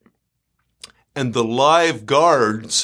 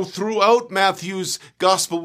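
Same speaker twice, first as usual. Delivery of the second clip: ordinary talk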